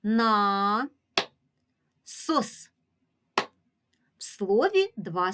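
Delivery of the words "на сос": На and сос said separately, each held and distinct; syllable-by-syllable teacher demo; split into syllables. Shown as a demonstration. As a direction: break it into На, long pause, сос. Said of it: "поділимо його на склади".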